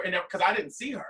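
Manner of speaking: normal talking voice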